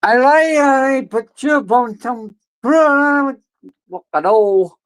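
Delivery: very high slow squeak; squeaky high voice. Acted. Squeaky silly voice.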